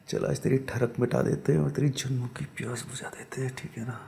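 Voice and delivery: soft unvoiced whisper; seductive whisper. The man promising the witch in a hushed whisper. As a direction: breathe it close and low.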